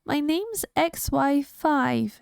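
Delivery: a touch of sniffly sadness; sniffling. Acted. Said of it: "he sniffed".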